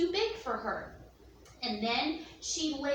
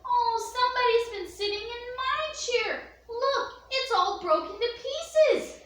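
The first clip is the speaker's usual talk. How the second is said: higher, louder sorrowful cub; little wee bear crying; 8 semitones higher, 4 dB louder than usual. Acted. Wee bear showing his family the broken chair, high crying voice. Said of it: "the poor little wee bear cried".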